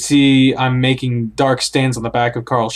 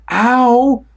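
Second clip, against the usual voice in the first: sharp cry of pain; 12 semitones higher, 5 dB louder than usual. Acted. Pain cry after fall.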